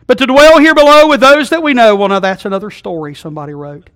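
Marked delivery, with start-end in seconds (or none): 0.1-2.0 higher, louder, building to the punchline; mock-grand recitation. Preacher setting up the rhyme's joke, high and loud. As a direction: ham up the line before the joke.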